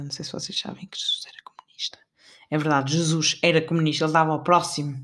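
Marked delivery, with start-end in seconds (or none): 0.0-2.0 hushed secretive whisper; whispering aside. Real, not acted. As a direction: lean in and whisper it. Conspiratorial aside to viewers.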